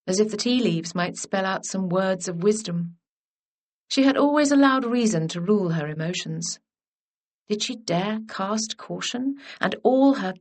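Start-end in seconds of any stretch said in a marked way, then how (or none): none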